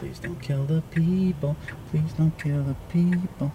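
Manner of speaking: soft plea. Real, not acted. Passenger begging the car not to hurt anyone, soft plea. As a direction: plead gently, drawing the words out.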